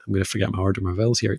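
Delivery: ordinary delivery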